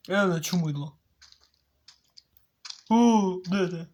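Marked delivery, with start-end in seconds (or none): none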